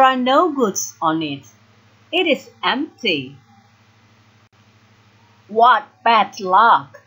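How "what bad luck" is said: loud, slow, cross exclamation; frustrated, heavily spaced words; angry. A narrator's reading. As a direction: spit out each word slowly in anger. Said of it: "he says angrily".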